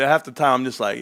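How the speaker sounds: ordinary talk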